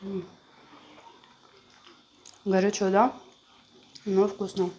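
speaking between bites of dumpling; talking while chewing. Real, not acted. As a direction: mouth partly full of food. Seen 3: woman chews dumpling while talking; heat itself not visible.

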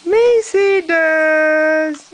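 very slow, high and loud, drawn out; excited reveal. Acted. Reading to the baby at the big reveal, drawn out high and loud. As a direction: stretch the name out to delight the baby.

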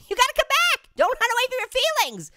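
high, playful pleading call; calling after. Pretend. Gamer calls after prey swimming away, high pleading. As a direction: call the runaway back in a high voice.

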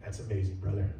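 a hint of a gentle pastor. Mentor affirming a stubborn young man, gently. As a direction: say it gently and warmly. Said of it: "He would say".

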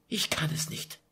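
breaking down into a soft whisper; sobbing whisper. Acted. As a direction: plead through tears, barely audible. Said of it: "Schluchzend warf sie sich".

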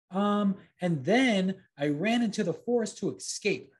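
put-on high, hesitant boy fibbing; nervous little liar; lying puppet boy. A narrator's reading. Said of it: "he continued".

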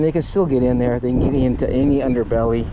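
ordinary talk, nothing marked

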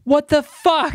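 high-pitched mock outburst of disbelief; imitating shocked dad. Dad catching his daughter beating someone up, mock shock. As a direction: act out a father's stunned double take.

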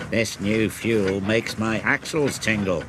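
plain reading voice

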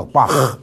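"bach" ending in a rough German throat rasp; heavy guttural. Shown as a demonstration. Teacher likens chi to German bach, heavy guttural ch. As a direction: scrape the ch of "bach" hard in the throat. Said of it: "key是很重的喉音".

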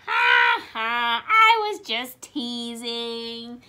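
loud, slow, acted "ha, ha"; laughing tease. A narrator's reading. Elmer reveals his joke to Wilbur, loud hearty mock laugh. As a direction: boom out a storybook laugh, stretching the words. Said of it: "laughed Elmer".

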